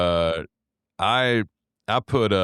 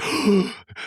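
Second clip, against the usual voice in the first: mock gasp; 13 semitones higher than usual. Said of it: "they'd be like".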